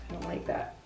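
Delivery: ordinary delivery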